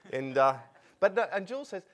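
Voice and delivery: ordinary talk, nothing marked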